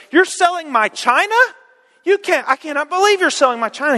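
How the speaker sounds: high, indignant wife voice; outraged that her china is being sold; shocked wife. Acted. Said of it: "she starts looking down the list and goes".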